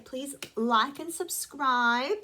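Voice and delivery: slow, drawn-out plea. Real, not acted. Drawn-out channel plug.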